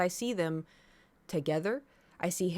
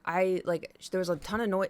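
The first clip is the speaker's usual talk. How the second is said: normal talking voice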